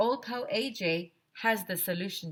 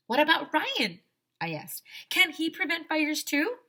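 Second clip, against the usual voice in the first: put-on eager kid voice; 9 semitones higher than usual. Kid asks bear nervously.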